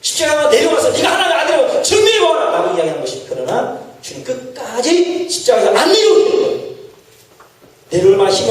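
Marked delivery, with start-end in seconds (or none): 0.0-2.5 higher, shouted jeer that peaks at the end; scornful crowd shouting a challenge; mocking crowd. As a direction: hurl the dare like the scoffing crowd. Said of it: "유대인들이 말하기를".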